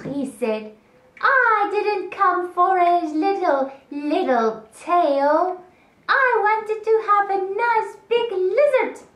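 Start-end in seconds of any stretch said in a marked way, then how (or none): 1.2-9.1 high, loud complaint; upset snake. Acted. Snake left with just a little tail, upset and complaining. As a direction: whine indignantly, stretching the vowels. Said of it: "very upset".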